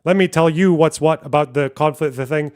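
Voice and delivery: hammed-up condescending bro; mock lecturing dude. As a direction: lord it over them like a know-it-all. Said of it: "Being like".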